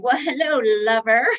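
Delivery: high, hammed-up come-hither hello; mock seductive greeting. Woman greeting her martini like a lover, mock seductive.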